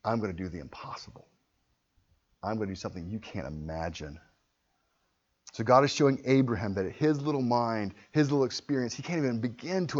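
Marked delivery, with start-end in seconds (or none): 0.0-4.2 soft, lower promise; lightly voiced God, hushed; quiet God. God promising Abraham, quiet low assurance. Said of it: "He said".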